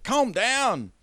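high-pitched character command; Eddie. Acted. Man telling his snarling dog off, high put-on voice. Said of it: "He's like".

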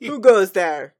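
loud royal challenge, falling at the end; Luna's loud warning, hammed up; booming challenge. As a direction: boom it out like a princess on guard. Redoing Luna's warning louder.

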